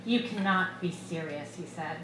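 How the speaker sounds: ordinary delivery, nothing marked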